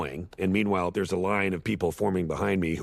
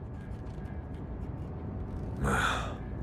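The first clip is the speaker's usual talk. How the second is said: long, quiet exhale; weary sigh; 7 semitones lower, 7 dB quieter than usual. Acted. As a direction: let out a long deflating sigh. Father gives up after rant.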